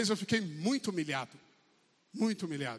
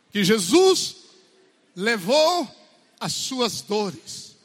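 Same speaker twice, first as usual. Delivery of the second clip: Jesus and levou hit loud, dores stretched slow; loud, drawn-out declaration of Jesus carrying pain; emphatic preaching; 2 semitones higher, 10 dB louder, much slower than usual. Acted. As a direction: land each word with weight. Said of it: "emphatic preaching".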